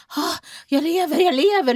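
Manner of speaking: high, gasping imitation of crying joy; mock tearful relief. Revived passenger realises he is alive, mock crying. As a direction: cry out in hammed-up relief, voice jumping up. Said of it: "och börjar gråta".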